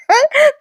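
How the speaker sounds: quick high laugh; a real giggle; short high giggle. Real, not acted. Laughs at own joke. (खुदकन हसू)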